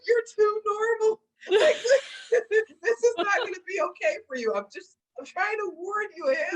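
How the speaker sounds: high-pitched, half-laughing mock warning. To her boyfriend, a giggling high mock warning. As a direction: squeal it out through laughter.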